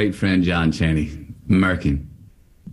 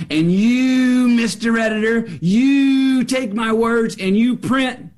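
much higher, slow, rising indignation; mock outrage; 14 semitones higher, slower than usual. Weatherman accuses his editor, hammed-up high outrage.

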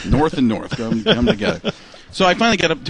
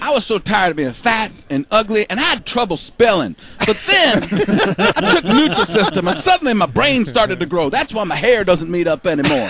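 lightly higher folksy ad voice; Terry Bradshaw impression; 7 semitones higher, 3 dB louder than usual. Comedian mocks Bradshaw's Nutrisystem ad, whiny impression. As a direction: whine it like a diet ad. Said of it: "It's like".

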